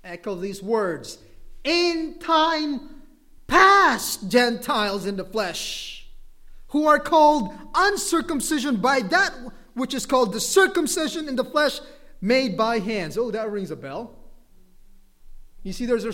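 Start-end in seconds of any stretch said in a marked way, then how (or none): 1.6-13.2 loud, emphatic scripture reading; forceful preaching. Real, not acted. Preacher reading Ephesians to the congregation, forceful preaching. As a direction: proclaim the verse at full force.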